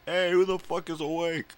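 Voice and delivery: loud, high holler through the house; yelling out. Acted. Streamer showing how he used to wake everyone, high loud yell. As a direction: yell it out to rouse the sleepers. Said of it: "just yell out".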